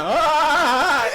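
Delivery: sudden high, loud yelp of pain; a shocked yell of pain as he lands on the locker; retold yell. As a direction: let out a sharp pained yell. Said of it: "I was just like".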